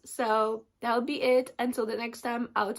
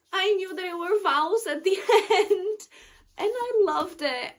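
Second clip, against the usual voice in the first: high, emotional voice breaking into a laugh; tearful joy; 9 semitones higher, 5 dB louder than usual. Real, not acted. Reader reacts to the vows at the end, tearfully overjoyed. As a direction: gush through happy tears, pitched way up.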